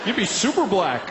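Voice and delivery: hammed-up slow drawl, grinning; mimicking American guy. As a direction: play the easygoing American buddy. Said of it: "He was like".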